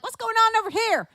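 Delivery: high, loud granny character voice; Grandma Crazy. Retold or imitated. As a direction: call out to a table as grandma. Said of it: "I was acting as grandma".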